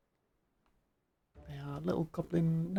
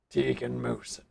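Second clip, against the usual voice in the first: big burly beast man; 6 semitones lower than usual. Acted. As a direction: speak it like a huge hulking beast. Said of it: "Big burly beast man".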